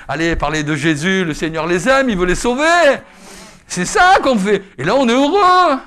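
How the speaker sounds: loud, high, building proclamation; full-force preaching. Acted. Preacher proclaiming God's love for the lost, loud and fervent. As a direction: proclaim it with rising fervour.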